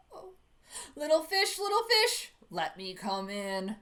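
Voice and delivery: loud coaxing shark voice; a villain shark at the door, loud and teasing; big bad shark. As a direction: lean on the fish, tempting and menacing. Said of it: "roared the shark".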